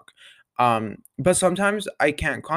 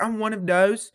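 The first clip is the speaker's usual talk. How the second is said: imitating Millie; 7 semitones higher than usual. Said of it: "She's like".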